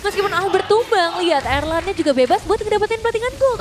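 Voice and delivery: high, loud hyped analysis; excited commentary. Real, not acted. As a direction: ride the hype of the play. Co-caster reacts to kills.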